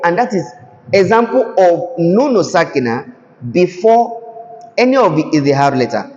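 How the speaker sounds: usual speaking voice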